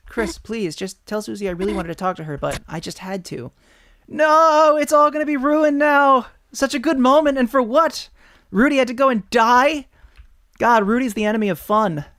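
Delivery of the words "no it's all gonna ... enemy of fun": high, loud exasperated yelling; hammed-up outraged shouting; dramatic ranting. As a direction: rant it over the top. Character rants at Rudy's collapse.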